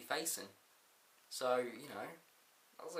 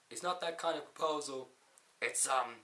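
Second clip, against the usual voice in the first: lightly attempted boss impression; Vince McMahon; 2 semitones higher, 4 dB louder than usual. The boss announces a proposal, in a poor impression. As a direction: try a wrestling boss's voice, badly. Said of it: "worst Vince McMahon accent I've ever done".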